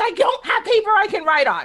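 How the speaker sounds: high, loud whiny protest; exasperated. Retold or imitated. Answering her husband about paper, high whiny protest. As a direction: wail it like a mock tantrum.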